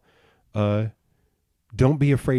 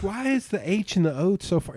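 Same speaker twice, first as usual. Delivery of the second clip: high mock puzzled whine; fussy question; 11 semitones higher than usual. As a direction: ask it high like a petty nitpicker. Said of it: "he's like".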